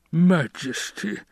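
high, slow, drawn-out plea; pleading Baron, rising at the end. A narrator's reading. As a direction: beg the ruler, voice pitched right up. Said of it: "the Baron pleaded".

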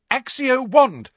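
high, slow summoning cry; cried-out spell. A narrator's reading. Hermione summoning the lost wand, high cry. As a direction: call the spell out high and stretched. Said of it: "cried Hermione".

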